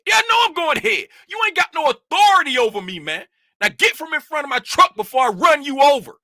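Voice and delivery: high, fast, loud re-enacted telling-off; angry defiance. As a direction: re-enact squaring up to the official, heated and rapid. Retold quarrel with the official.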